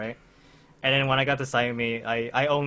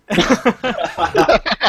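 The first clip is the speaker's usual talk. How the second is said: bursting laugh; 8 semitones higher, 8 dB louder than usual. Real, not acted. Laughing at the friend's plan.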